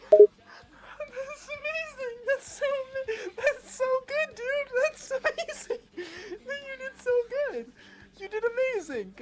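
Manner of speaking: very high, squealing crying laughter; laughing to tears. Real, not acted. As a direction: gush helplessly through real tearful laughter. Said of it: "you got me crying in my glasses".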